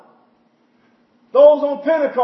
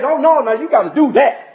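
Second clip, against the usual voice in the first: mocking quote; 5 semitones higher, 3 dB louder than usual. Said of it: "they'll say".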